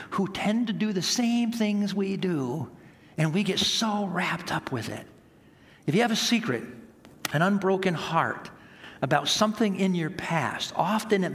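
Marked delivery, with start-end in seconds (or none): none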